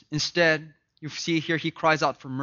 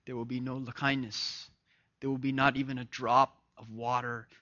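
low, soft grave pronouncement; solemn hush; 4 semitones lower, 7 dB quieter than usual. Real, not acted. Preacher telling listeners there is no mercy in hell, hushed and grave. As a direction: lower the voice to deliver the verdict.

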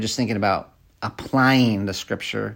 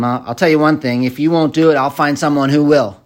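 louder, higher mock ultimatum; unwholesome ultimatum; 4 semitones higher, 6 dB louder than usual. Shown as a demonstration. Preacher demonstrating an angry ultimatum, clearly raised. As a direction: snap it like a fed-up boss. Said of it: "This is unwholesome".

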